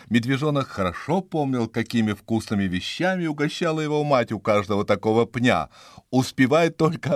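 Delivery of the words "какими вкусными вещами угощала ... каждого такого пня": ordinary delivery, nothing marked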